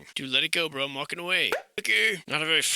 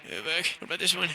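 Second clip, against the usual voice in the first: ordinary talk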